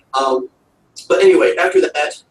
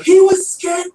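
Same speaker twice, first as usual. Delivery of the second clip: put-on high, loud British-accented yell; yelling Doctor; 14 semitones higher, 5 dB louder, slower than usual. Podcaster imitating the Doctor defending the pig, mock British yell. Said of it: "the doctor yells at him".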